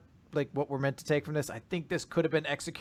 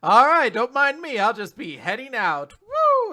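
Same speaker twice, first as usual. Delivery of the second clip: cartoon exit; 6 semitones higher, 6 dB louder than usual.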